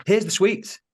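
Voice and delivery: high-pitched, pleading self-defence; indignant protest. Retold or imitated. Accused worker showing the sweets to bosses, indignant protest. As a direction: protest your innocence, voice pitched right up.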